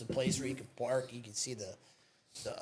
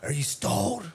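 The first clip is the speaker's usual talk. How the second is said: higher put-on stranger voice; scary-looking stranger; 6 semitones higher than usual. Imitating the stranger at the stalled car, put-on voice. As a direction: lightly imitate an odd guy pulling up. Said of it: "scary looking dude".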